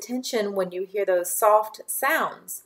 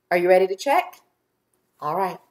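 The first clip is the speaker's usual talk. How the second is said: usual speaking voice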